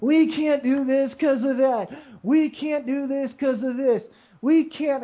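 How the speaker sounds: high, slow, nasal-mocking whine; whiny complainer. Mocking TV complainers in a high whiny voice.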